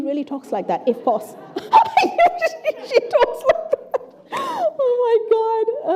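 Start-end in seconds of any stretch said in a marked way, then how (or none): 1.4-2.8 high burst of laughter. Real, not acted. Amused by interviewee's phrasing. 2.9-5.9 laughing while talking. Real, not acted. Laughing at quoted speech.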